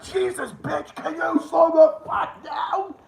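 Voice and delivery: very high, drawn-out mock shout; hammed-up squealed complaint; mock exasperation. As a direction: shout it in exaggerated disbelief, stretching the words. Acting out exasperation at fast talker.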